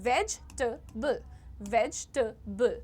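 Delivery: vegetable slowly as vej, tuh, bul; syllable by syllable. Shown as a demonstration. Teacher drilling students, vegetable in three separate syllables. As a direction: break vegetable into three slow beats. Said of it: "Just three syllables".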